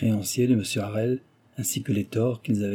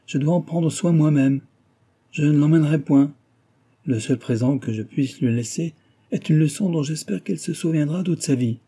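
colère autoritaire; 5 semitones higher, 3 dB louder than usual. A narrator's reading. Mari refuse avec colère.

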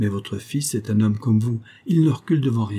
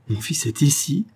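higher, rising excited question; uncontainable exclamation; 4 semitones higher than usual. A narrator's reading. Mother learning her son is here, excited cry. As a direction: let the question burst out, pitch climbing. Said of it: "s'écria madame Robin, incapable de se contenir".

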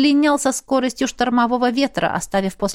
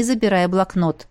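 plain reading voice

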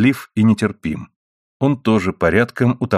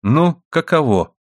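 indignant exclamation; 7 semitones higher, slower than usual. A narrator's reading. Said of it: "воскликнула она".

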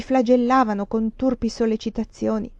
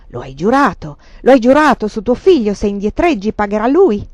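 louder threat; 11 dB louder than usual. A narrator's reading. Prilukov threatening over the music.

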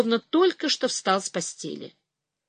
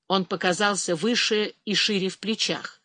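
ordinary delivery

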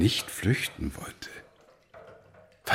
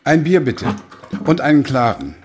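loud, high call across a noisy bar; calling out an order; 8 semitones higher, 6 dB louder than usual. Acted. Old man calling loudly to the barman for beer. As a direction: shout the order over the room.

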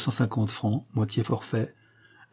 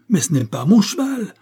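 higher and louder, desperate; despairing cry; 8 semitones higher, 5 dB louder than usual. A narrator's reading. Colonel cries out to Holmes in despair. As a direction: cry out in dismay. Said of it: "s'écria avec désespoir".

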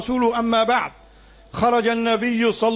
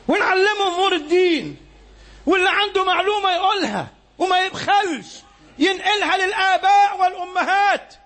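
raised, higher, urgent sermon voice; fiery preaching; 8 semitones higher than usual. Real, not acted. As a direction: exhort the crowd at full force. Preacher urging the congregation. (خطبة حماسية بصوت عالٍ)

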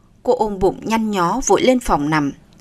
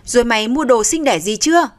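ordinary delivery

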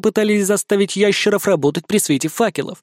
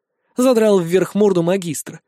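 usual speaking voice

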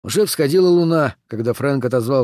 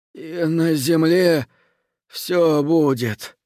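prayer through pain; 3 semitones higher, much slower than usual. A narrator's reading. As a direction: pray while fighting the pain. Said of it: "вскрикнул от боли".

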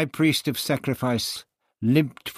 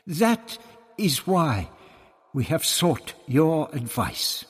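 high, slow, measured priestly voice; deliberate priest; 7 semitones higher, 6 dB louder, slower than usual. A narrator's reading. Tonilla explains seeking Barbara's advice, deliberate. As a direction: spell it out slowly, pitched up.

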